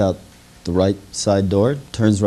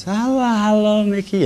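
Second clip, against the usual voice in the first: put-on drunk greeting; 12 semitones higher than usual. Drunk greets his friend.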